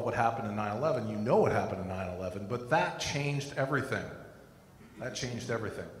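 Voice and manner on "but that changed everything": usual speaking voice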